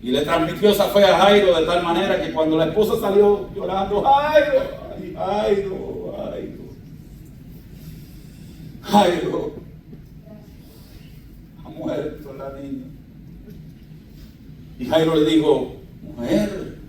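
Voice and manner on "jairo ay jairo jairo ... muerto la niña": a touch of wailing grief, fading softer; lightly acted weeping wife; mournful wife. La esposa sale llorando. (la esposa llora y llama a Jairo)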